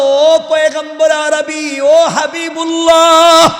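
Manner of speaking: high, loud, slow drawn-out call; voice from heaven calling. Retold or imitated. Allah's voice calling to the Prophet, loud drawn-out call. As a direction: call out the titles with long held endings. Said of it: "আওয়াজ আসলো".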